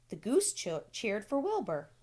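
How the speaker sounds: usual speaking voice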